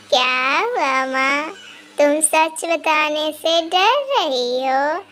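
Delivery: slow, eerie mocking witch; spooky laughing ghost. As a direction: taunt her slowly with a laugh. Witch mocks Rama.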